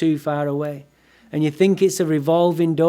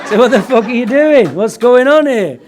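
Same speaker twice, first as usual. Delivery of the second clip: higher and louder, laughing through it; mock outraged yell; 6 semitones higher, 9 dB louder than usual. Confronting the texting girl beside him, a hammed-up loud yell.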